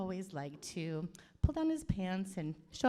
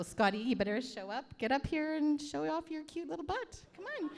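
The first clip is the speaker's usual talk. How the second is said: teasing call-up; 8 semitones higher than usual. Real, not acted. Auntie calls Scotty up to the front, high teasing coax. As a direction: pitch climbing high in playful coaxing.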